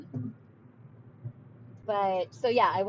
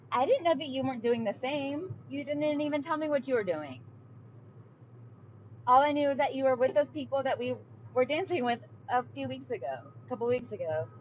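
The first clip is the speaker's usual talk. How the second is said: real agitation rising as she retells her reply; getting worked up; 6 semitones higher than usual. Real, not acted. Telling viewers about her fight with her husband, getting worked up. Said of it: "Now I'm getting worked up".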